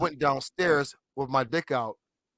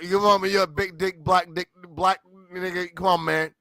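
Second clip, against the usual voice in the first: usual speaking voice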